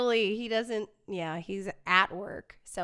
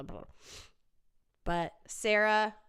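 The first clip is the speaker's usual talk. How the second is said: ordinary talk, nothing marked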